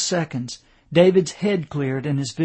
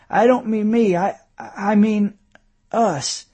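higher, halting words with mild effort; effortful; 6 semitones higher, 5 dB louder than usual. A narrator's reading. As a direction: struggle to get the meaning across. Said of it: "he said with an effort".

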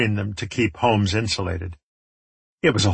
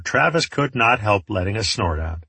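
plain reading voice

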